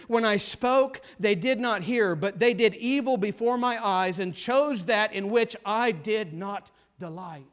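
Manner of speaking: measured, solemn reading of scripture. Acted. Preacher reading Isaiah sixty-six to the congregation, solemn and measured. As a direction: read God's words with weight, slowing on the last line. Reads the Isaiah passage aloud.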